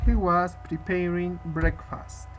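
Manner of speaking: slow, clear model reading; slow model sentence. Shown as a demonstration. Teacher modelling the English answer, slow and clear. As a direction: say it slowly for the student to copy.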